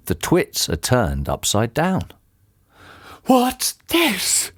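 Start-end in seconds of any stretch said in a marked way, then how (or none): 3.2-4.5 high, astonished character voice; shocked gasp. A narrator's reading. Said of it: "gasped Mister Twit".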